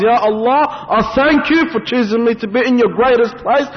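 high, fervent plea to God; impassioned demonstration of gratitude; thankful prayer. Acted. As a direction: cry out thanks with rising fervour. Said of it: "you should thank Allah".

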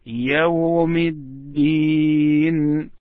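يوم الدين slow, الدين drawn out and stressed; stress on the last word. Shown as a demonstration. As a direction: stretch out الدين for the student. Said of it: "But the last one".

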